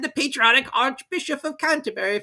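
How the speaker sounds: very high, pompous squeaky lecture; pompous Mouse. Acted. Mouse lecturing the wet party, high and pompous. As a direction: recite dry history grandly. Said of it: "said the Mouse".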